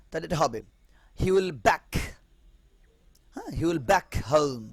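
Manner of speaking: ordinary delivery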